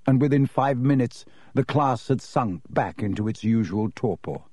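usual speaking voice

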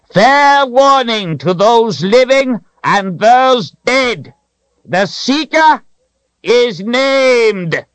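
high, ringing proclamation; calling out. A narrator's reading. Wizard calls warning to the sky, high proclamation. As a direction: proclaim it loudly to the heavens, drawn out. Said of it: "To the sky he called out".

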